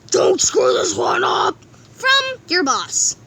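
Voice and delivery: slow, deliberate boss read; stern boss. Acted. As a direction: drag out the warning. Reading the boss's letter.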